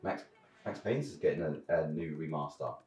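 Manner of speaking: normal talking voice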